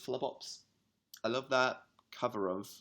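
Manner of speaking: ordinary delivery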